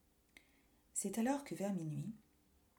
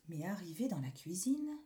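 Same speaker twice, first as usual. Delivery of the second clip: ordinary delivery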